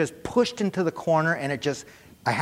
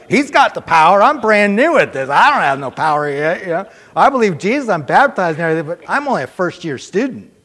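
nervous excitement; 3 semitones higher, 9 dB louder than usual. Retold or imitated. Retelling his nervous thoughts.

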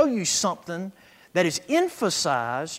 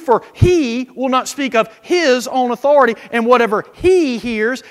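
preached Scripture reading; 3 semitones higher, 8 dB louder than usual. Acted. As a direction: proclaim the verse to the congregation. Reads Scripture aloud.

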